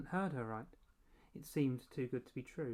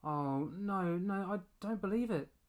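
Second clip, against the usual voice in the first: cry out; 6 semitones higher, 5 dB louder than usual. A narrator's reading. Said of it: "I heard Mum cry out".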